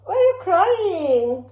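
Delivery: high, loud, drawn-out cooing; sing-song mommy voice. Real, not acted. Mother soothing crying baby.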